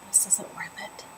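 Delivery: breathy under-the-breath giving up; resigned whisper. Retold or imitated. To herself while the boss yells, a whispered giving up. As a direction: whisper it to yourself, defeated.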